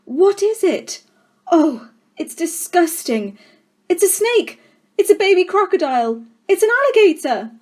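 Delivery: put-on high, loud squealing kids; children shrieking at a newt in the glass; children shouting. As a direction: shriek it like panicked schoolchildren.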